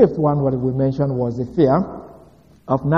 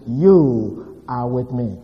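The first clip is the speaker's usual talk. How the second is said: YOU stretched out slowly with a falling tune; stress on YOU; much slower than usual. Shown as a demonstration. Preacher recalling what David kept recognizing, stress on YOU. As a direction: linger on YOU before finishing the phrase. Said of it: "we try to emphasize the word".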